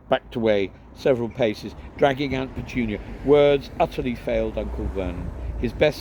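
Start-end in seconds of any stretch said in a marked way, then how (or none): none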